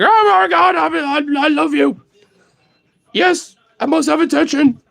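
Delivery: high, loud frantic dog voice; crazy, overexcited dog. Retold or imitated. Dog begging the owner for attention, crazy excited. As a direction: gush in a high, frenzied voice, desperate for attention. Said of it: "he boofed and went crazy".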